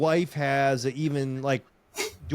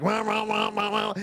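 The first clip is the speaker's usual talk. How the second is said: higher, babbling imitation of a mumbling Southern coach; mock water boy coach; 7 semitones higher than usual. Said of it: "that water boy coach".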